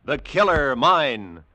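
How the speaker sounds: normal talking voice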